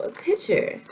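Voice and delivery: "pitcher" said with a t-ch sound, high and emphatic; own accent. Real, not acted. Correcting herself on the word, "pitcher" with t-ch. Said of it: "I just have an accent".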